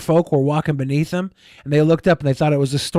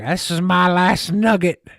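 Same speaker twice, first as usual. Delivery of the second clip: put-on slow, higher old miner drawl; old prospector; 5 semitones higher, slower than usual. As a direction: play a greedy old prospector. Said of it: "some old prospector".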